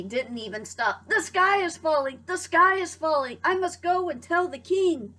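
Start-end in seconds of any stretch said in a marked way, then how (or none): 1.1-5.1 high, louder frantic cry; panicked alarm. Acted. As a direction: sound the alarm while running. Hen running in panic.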